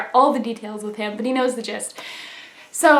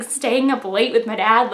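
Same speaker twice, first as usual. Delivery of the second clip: ordinary delivery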